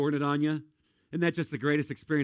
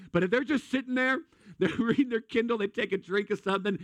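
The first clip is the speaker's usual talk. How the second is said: ordinary talk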